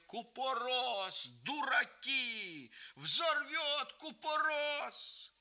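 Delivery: high, slow, urgent cries; shrieking warning. A narrator's reading. Grandmother rushing into the fire, shrieking. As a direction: cry out the danger. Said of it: "вскрикивая".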